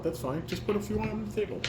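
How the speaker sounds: usual speaking voice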